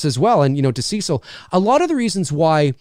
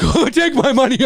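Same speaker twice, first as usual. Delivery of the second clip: eager fans; 9 semitones higher than usual. Retold or imitated. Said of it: "And everyone's like".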